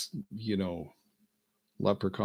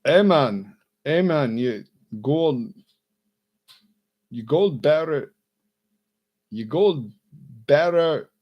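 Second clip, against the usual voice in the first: put-on loud, high over-the-top Jamaican accent; bad Jamaican accent; 7 semitones higher, 14 dB louder, slower than usual. Comedian retrying the Jamaican pilot, loud mock Jamaican accent. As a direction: commit hard to an unconvincing Jamaican. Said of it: "I can't do Jamaican accent".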